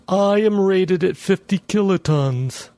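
high, slow machine voice with pride; a trace of pride in a bomb's voice; proud talking bomb. Acted. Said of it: "with a trace of pride".

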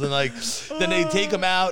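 ordinary talk, nothing marked

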